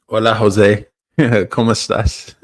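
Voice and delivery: trying out a Spanish accent. Shown as a demonstration. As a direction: greet in Spanish with a learner's accent. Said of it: "How's my Spanish accent?".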